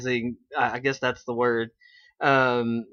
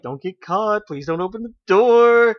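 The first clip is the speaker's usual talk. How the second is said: higher, louder, frantic mock plea; panicked plea; 6 semitones higher, 6 dB louder than usual. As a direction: beg in a hammed-up panic. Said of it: "He's like".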